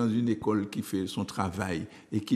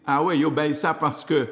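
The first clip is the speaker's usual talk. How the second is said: louder, scoffing imitation of a lame excuse; mock the one who blames skin tone; 5 semitones higher, 6 dB louder than usual. Mimicking someone who blames a lighter colleague, scoffing and louder. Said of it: "Vous dites".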